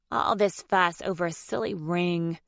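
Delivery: ordinary delivery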